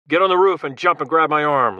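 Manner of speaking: loud call over the wind. Acted. Logan calling to the Russian on the roof, loud command. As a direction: shout the order across the gap. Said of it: "Logan replied loudly".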